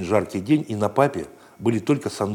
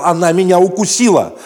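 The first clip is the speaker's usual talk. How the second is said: loud, higher yelp of pain; child's yell; 8 semitones higher, 8 dB louder than usual. Acted. Bitten boy crying out to everyone in the park, loud. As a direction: shout it out just after the bite. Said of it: "Папа кричал".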